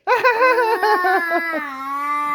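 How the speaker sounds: high-pitched burst of laughter. Real, not acted. Adult laughs amid child's crying. (mataas na halakhak)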